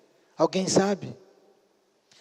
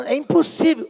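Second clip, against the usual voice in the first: high, louder objection as the mother; mock protest; 9 semitones higher, 5 dB louder than usual. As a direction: protest it as impossible, pitch jumping up. Mãe protesta contra o pedido. (protesto agudo, imitando a mãe)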